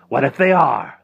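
high, loud challenge; loud retort. Acted. Rictus answering Harvey's charge of fakes, loud retort. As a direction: fire it back big and lifted.

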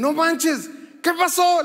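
mock shock. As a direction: sell the fake news, all worked up. Daughter teasing with fake news. (conmoción fingida, más aguda y fuerte)

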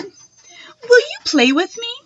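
put-on girl begging a turtle to play, high and drawn out; pleading question. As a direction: stretch the question out, hopeful and high.

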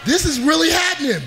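high-pitched terrified wail; panicked. Retold or imitated. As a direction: panic as the horse bounces. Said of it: "I'm like".

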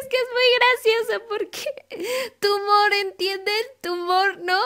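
high, slow, half-crying laugh at a dumb pun; teary giggling explanation of a bad pun; laughing through the words. Real, not acted. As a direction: squeak it out while cracking up at the joke. Sarcastic joke about a silly pun.

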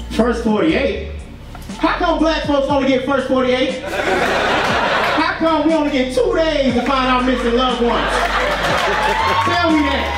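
ordinary talk

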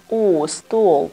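"U, stool" with a drawn-out long oo. Shown as a demonstration. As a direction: hold the oo in "stool". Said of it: "Âm u dài nha".

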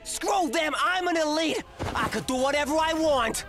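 high-pitched entitled rant; arrogant outburst. Acted. Captive dismissing his victims, high arrogant protest. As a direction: throw it out high and defiant.

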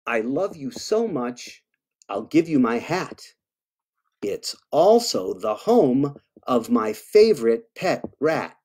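storybook read-aloud; reading to children. Acted. Author reads a monster love poem to kids, storybook voice. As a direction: read it sweetly to little listeners.

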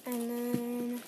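usual speaking voice

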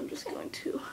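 quick unvoiced whisper aside. Real, not acted. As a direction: drop to a whisper as if sharing a secret.

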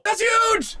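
high, shouted congratulation; excited cheer. Real, not acted. Congratulating friend on paid trip, excited shout. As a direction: hype the friend up, high and thrilled.

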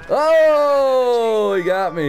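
high loud hammed-up dying cry; overacted death scene. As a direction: overplay getting killed. Dramatic death in melee.

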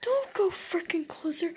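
whispered frightened plea; terrified whisper. Real, not acted. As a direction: beg in a scared whisper. Scared pleading whisper.

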